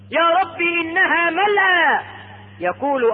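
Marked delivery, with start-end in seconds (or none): 0.1-2.0 high, drawn-out plaintive appeal; plaintive protest. Acted. Man telling God Paradise is full, drawn-out protest. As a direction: stretch the complaint out high.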